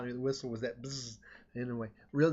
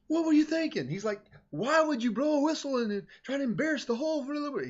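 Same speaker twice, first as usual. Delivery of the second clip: much higher, louder, exasperated telling-off; angry scolding; 10 semitones higher, 4 dB louder than usual. Retold or imitated. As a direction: demand an answer from the kid in disbelief. Director scolding student in office.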